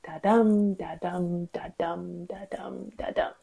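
even heartbeat pulse, weak "da", strong "dum", fading away; iambic heartbeat. Shown as a demonstration. Teacher demonstrating five-beat line rhythm, iambic heartbeat. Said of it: "gives us that iconic heartbeat".

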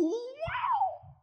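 normal talking voice

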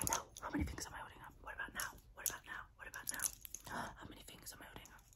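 close-mic whisper, guiding the listener; ASMR whisper. Acted. Whispering to the viewer during an ASMR roleplay.

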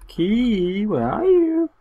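high playful calling. Pretend. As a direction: call the key like a lost pet. Calling for the key.

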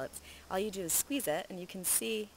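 ordinary talk, nothing marked

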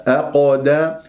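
أقدا with light أ, dark bouncing ق; thin hamza, then heavy qaf echo; heavy qalqala. Shown as a demonstration. Teacher modelling the correct start, heavy qalqala. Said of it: "فتفخيم قلقلة".

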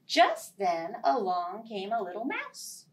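usual speaking voice

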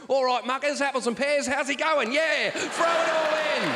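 cockney it up for tourists. Pretend. Comic greeting tourists in a hammed-up Cockney accent. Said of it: "really cockney it up".

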